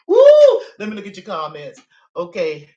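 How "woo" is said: high-pitched "woo" after laughing; loud high whoop. Real, not acted. Storyteller laughing over the viewers' comments, loud whoop. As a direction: let out a big, piercing whoop.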